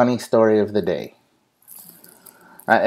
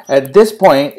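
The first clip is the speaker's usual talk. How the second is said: ordinary talk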